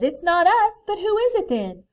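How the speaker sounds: high, loud storybook exclamation; puzzled protest. A narrator's reading. Bear ruling out the friends, puzzled loud protest. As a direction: exclaim it, wanting an answer.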